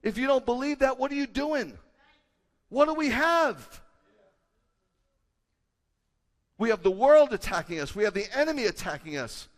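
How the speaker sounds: impassioned crescendo; rising preaching climax. Acted. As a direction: drive the sermon to its peak. Sermon climax building.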